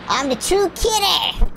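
high, showy boast; mock proclamation. Gamer boasting about kidding, mock proclamation.

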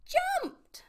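sudden high, loud storybook burst; excited exclamation. A narrator's reading. Narrator reaches Pip-Pip's leap into the ocean, exclaiming excitedly.

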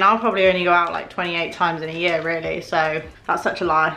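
normal talking voice

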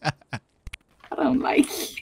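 very slow, whiny mock complaint; playful whine. Real, not acted. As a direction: drag it out in a teasing pout. Playful rejection after his teasing.

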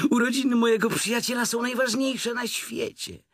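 high-pitched excited little Piglet; exclaiming Piglet. A narrator's reading. Piglet fretting over a friend's birthday, high exclaiming. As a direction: cry it out high and earnestly as Piglet. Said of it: "Wykrzyknął Prosiaczek".

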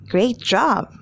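ordinary talk

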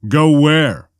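quick dismissive comeback; retort. A narrator's reading. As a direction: throw the question straight back. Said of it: "Han retorted".

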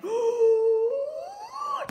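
sharp delighted high gasp; loud high gleeful gasp; high excited gasp. Acted. Character gasps with glee.

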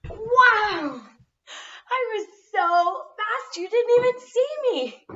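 high, loud and breathless; excited. Acted. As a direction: boast with breathless glee. Back from sprinting, boasting.

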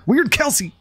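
high, bright-eyed shout-out to a supporter; excited greeting. Real, not acted. Streamer greets a chat supporter, excitedly. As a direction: call the name out with delight.

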